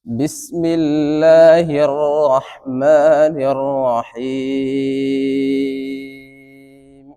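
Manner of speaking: melodic chant, last word long and sung; Quranic recitation. Shown as a demonstration. Teacher recites the taawwuz for students, chanted. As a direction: draw out রাজিম in a slow sung line.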